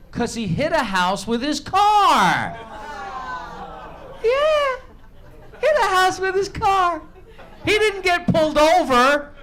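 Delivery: loud and high, incredulous; outraged. Real, not acted. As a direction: blow up at the absurdity. Outraged retelling of news.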